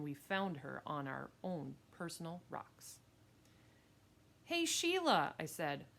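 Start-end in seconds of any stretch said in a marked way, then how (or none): 4.4-5.3 high, louder call to a rival; confronting call. Acted. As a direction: call her out, pitched well up. Boy confronts girl on his rocks.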